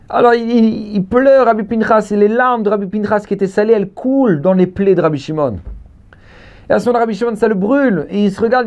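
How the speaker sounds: high, insistent sermon storytelling; fervent high-pitched preacher; impassioned preaching. Real, not acted. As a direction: declaim it high, driving the story on. Preaching at full force.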